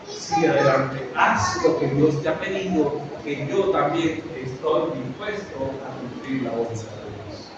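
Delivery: low, soft, hushed voice of a son comforting his father; reassuring son. Acted. Isaac comforts his father Abraham at the altar, low and soft. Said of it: "Isaac mismo animó a su papá y le dijo".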